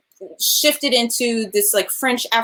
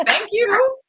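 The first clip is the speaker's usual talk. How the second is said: laughing; 11 semitones higher than usual. Real, not acted. Laughing after retelling.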